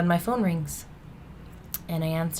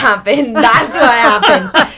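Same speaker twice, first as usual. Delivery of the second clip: loud, higher, slow through the laughter; real laughing; 4 semitones higher, 15 dB louder, slower than usual. Real, not acted. Speaker confessing the failed trick to listeners, laughing loudly. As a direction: laugh helplessly at your own mishap. Laughing over embarrassing drug-test mishap.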